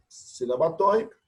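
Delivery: ordinary delivery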